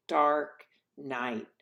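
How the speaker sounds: ordinary delivery, nothing marked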